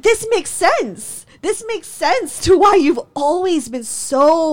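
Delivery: high, slow, emphatic retort; heated accusation. Acted. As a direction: stretch out the indignant realisation. Acts out her accusing realisation.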